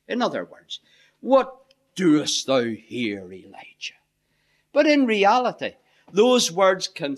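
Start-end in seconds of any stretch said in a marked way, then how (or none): 1.2-3.9 slow, hushed and accusing, a rebuke; searing rebuke. Shown as a demonstration. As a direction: lean hard on WHAT, drop to a near whisper on Elijah. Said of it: "read into it a certain inflection on the words".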